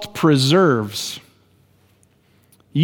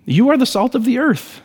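much higher, ringing pulpit proclamation of the line; soaring preached declaration; 8 semitones higher than usual. Shown as a demonstration. Pastor proclaiming to his congregation, high and ringing. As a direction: proclaim "You are the salt of the earth" high and strong, leaning on "are". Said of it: "final climactic preached restatement".

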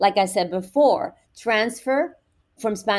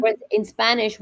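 normal talking voice

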